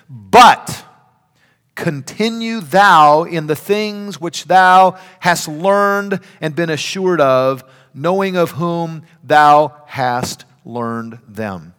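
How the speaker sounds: loud, slow, drawn-out proclamation; ringing pulpit Bible reading; emphatic scripture reading. Acted. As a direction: proclaim the verse slowly and loudly, weighing each phrase. Reads the sermon text aloud.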